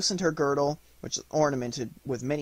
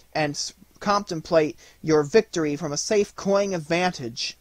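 high, loud lady's plea, gradually easing; crying out in entreaty; 6 semitones higher, 5 dB louder than usual. A narrator's reading. Clara begging the wounded hero to stay, high pleading cry. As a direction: implore him in a raised, high voice. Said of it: "But Miss Clara now cried".

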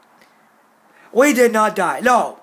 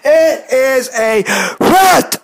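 slow, emphatic angry raised voice; angry insistence; 3 semitones higher, 4 dB louder, much slower than usual. Real, not acted. Insisting to a commenter it is a threat, angry insistence. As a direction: hammer out each word angrily.

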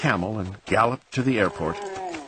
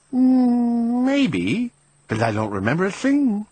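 sighing; 12 semitones higher, 5 dB louder, slower than usual. Acted. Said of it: "he sighed".

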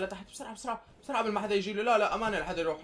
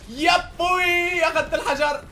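high, loud and drawn out very slowly; real thrilled whoop; excited cry; 10 semitones higher, 8 dB louder, slower than usual. Real, not acted. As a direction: stretch the joy of finally winning. Finally grabs the stone.

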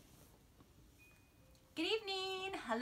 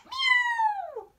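high, loud squealing meow; cat meow; 11 dB louder than usual. Acted. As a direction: yowl like a startled cat. Cat sound in story.

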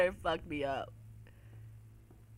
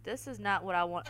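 ordinary delivery

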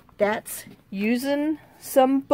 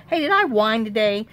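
ordinary talk, nothing marked

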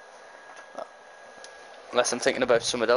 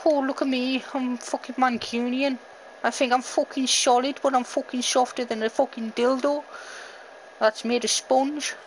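much higher-pitched mock Mancunian voice; attempted Mancunian accent; 10 semitones higher than usual. Player mocking himself as a Mancunian, attempted Mancunian accent. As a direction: put on a bad Mancunian accent that slips into Scouse. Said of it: "tried to do a Mancunian accent".

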